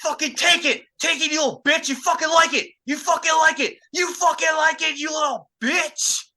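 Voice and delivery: the woman screaming at him from on top. Retold or imitated. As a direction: shriek it like a crazed woman pinning him down. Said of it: "and just screaming".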